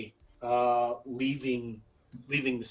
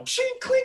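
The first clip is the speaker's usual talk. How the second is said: clashing blades; 5 dB louder than usual. Retold or imitated.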